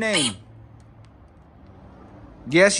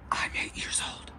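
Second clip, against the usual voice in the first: put-on "I'm eight years old" in a hushed whisper; whispered quote; 11 dB quieter than usual. Ghost hunter whispering back what the spirit box said. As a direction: whisper the words the girl's voice said. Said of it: "I just heard a girl's voice say".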